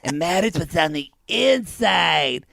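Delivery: put-on voice, slowing on the last part; mocking quote. Co-host mocking Darcy's line about inner beauty, put-on voice. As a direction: recite it with hammed-up sincerity. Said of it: "I have another strong tea, quote and quotable".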